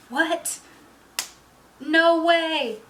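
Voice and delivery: high, loud gasping disbelief; reacting to a jaw-dropping twist; shocked disbelief. Reader stunned by the ending's twist, high loud disbelief.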